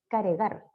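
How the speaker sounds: "caregar", extra e between r and g; inserted vowel. Shown as a demonstration. Teacher repeating the faulty form to class. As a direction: say "caregar", three syllables. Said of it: "y suena como cargar".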